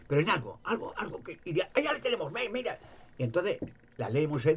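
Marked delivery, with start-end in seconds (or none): 1.6-2.8 gloating. Retold or imitated. Pharisees gloat over catching Jesus.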